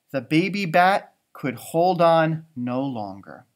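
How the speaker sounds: ordinary delivery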